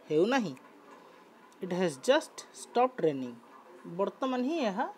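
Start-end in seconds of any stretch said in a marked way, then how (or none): 1.6-3.4 slow model reading. Shown as a demonstration. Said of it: "teacher rereads example".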